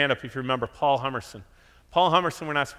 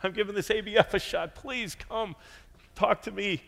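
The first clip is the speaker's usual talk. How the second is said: begging and pleading; 8 semitones higher than usual. Retold or imitated. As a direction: plead like a lonely newcomer. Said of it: "begging and pleading".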